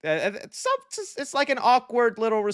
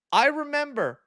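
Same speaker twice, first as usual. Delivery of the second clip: ordinary talk, nothing marked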